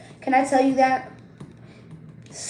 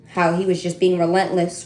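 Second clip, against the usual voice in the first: normal talking voice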